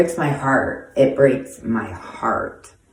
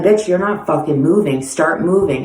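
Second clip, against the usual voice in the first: louder commanding order; angry order; 4 semitones higher, 4 dB louder than usual. Retold or imitated. Telling the woman on the ground to move, angry. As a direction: push her to move, hard.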